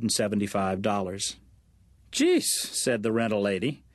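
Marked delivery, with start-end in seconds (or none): 2.1-2.4 sharp, very high jeez of disbelief; startled, scoffing jeez; exasperated surprise. Acted. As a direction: toss out a high, loud jeez at her pickiness. Rental lady reacts to demands.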